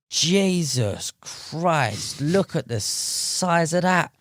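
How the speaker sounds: slow, high, drawn-out amazement; awestruck. Retold or imitated. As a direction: stretch it out in awe. Awed at huge fish.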